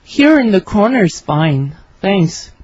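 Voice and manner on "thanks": ordinary talk, nothing marked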